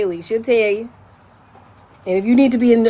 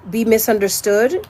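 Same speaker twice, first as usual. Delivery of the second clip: ordinary delivery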